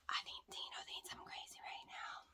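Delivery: hushed so he won't hear; whispering. Real, not acted. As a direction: whisper it low. Aside about husband nearby.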